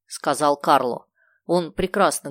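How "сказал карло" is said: plain reading voice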